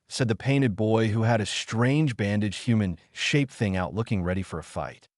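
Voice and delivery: plain reading voice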